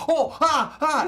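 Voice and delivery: high yelps of a man on fire; burning yelps. Retold or imitated. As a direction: yelp like a game character burning. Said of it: "they do the efforts".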